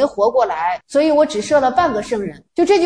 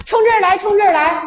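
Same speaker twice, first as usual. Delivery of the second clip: defiant challenge; 6 semitones higher than usual. Acted. Visitor baring his chest, challenging.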